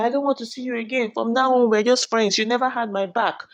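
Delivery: high, indignant break-up rant; mock angry. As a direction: rattle off her grievances, imitating her. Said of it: "She got angry".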